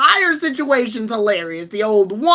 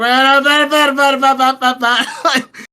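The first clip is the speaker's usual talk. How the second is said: imitated reversed music; 3 dB louder than usual. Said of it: "And it's like".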